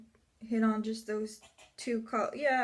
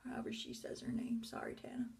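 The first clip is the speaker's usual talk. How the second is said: whisper; 10 dB quieter, faster than usual. Real, not acted. Whispered asides.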